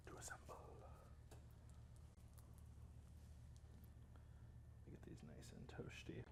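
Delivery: breathy whispered aside; whispering. Real, not acted. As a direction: drop into a hush. Unvoiced whisper.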